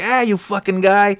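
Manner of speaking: high-pitched playful jab; hammed-up chummy cursing; mock trash talk. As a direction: rib a new buddy brashly. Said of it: "dropping F-bombs with this one news reporter".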